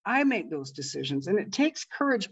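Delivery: ordinary talk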